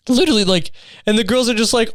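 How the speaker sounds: usual speaking voice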